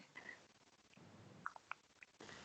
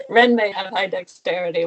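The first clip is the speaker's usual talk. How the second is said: usual speaking voice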